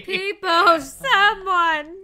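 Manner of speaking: very loud, high hollering; mock cry for help. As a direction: holler for Peepo in playful panic. Calling for help in game.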